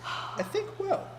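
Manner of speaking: slow, high, whispered quip. Real, not acted. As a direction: breathe it out slowly as a joke. Joking answer, breathy and slow.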